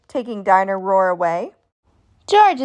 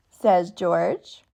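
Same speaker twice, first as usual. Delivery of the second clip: usual speaking voice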